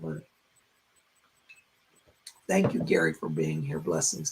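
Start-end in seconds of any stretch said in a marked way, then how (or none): 2.5-4.3 raspy voice. Real, not acted. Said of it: "Sorry for my raspy voice".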